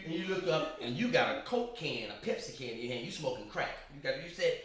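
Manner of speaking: normal talking voice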